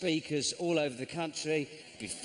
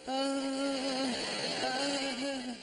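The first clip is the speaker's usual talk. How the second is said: high yelps; 11 semitones higher than usual. Retold or imitated. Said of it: "Like you're in Swan Lake".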